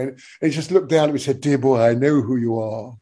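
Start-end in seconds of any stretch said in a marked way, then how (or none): 1.4-3.0 low, dry posh put-down; a touch of an aristocratic gent. Retold or imitated. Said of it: "but very, very posh".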